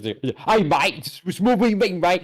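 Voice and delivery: lightly put-on British street accent, laughing; tossing off a half-laughing cockney mate. Teasing British and Australian speech with a light mock British accent.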